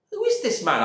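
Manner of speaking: loud, indignant retort; angry husband. Acted. Husband shouting at the stranger in his house, indignant. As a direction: stress my house, claim it back.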